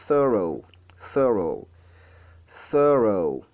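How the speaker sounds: repeated "Thorough" with air blown through TH; deliberate, falling "Thorough"; model pronunciation. Shown as a demonstration. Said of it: "on essaie de faire sortir de l'air".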